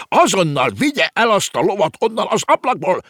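high, loud outraged tirade; shrill scolding at the top of the voice; indignant screeching. A narrator's reading. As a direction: screech orders like an outraged landlord. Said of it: "rikálcsolta felháborodottan".

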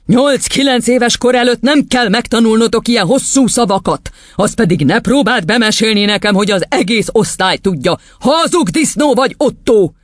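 high, indignant scolding; tyrant headmistress, accusing. Acted. Headmistress calling a boy a liar, high and indignant. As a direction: lay into the lying pupil up high.

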